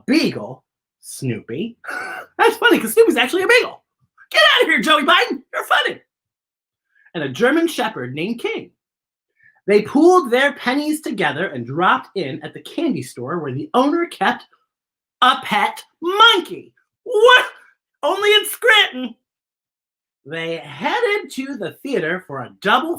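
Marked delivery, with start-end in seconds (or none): 4.3-6.0 mock disbelief. Host teasing the book's joke, mock shouting. 17.0-19.1 high, loud gasped what; mock surprise. Host reacting to the monkey, mock surprised.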